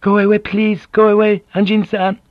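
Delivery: high, loud anguished cry; cried out. A narrator's reading. Beaten wife crying out at Blackthorne to leave, loud and high. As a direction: cry out to drive him away. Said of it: "but she cried out".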